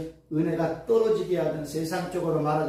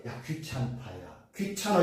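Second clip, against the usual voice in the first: low, soft, fed-up grumble; annoyed person; 6 semitones lower, 7 dB quieter, slower than usual. Retold or imitated. Preacher voicing a person tired of requests, low and soft. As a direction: brush off a pest, wearily. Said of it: "사람은 뭐라".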